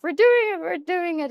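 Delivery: high, loud excited retelling; elated outburst. Retold or imitated. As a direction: squeal it to a friend on a call. Acting out an elated FaceTime call.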